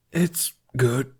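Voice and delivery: hoarse "It's good" after a burning sip. A narrator's reading. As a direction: rasp "It's good" through a burnt throat. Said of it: "his voice hoarse".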